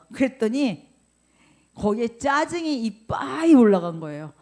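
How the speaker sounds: preaching. Acted. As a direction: lean into the scorching sun.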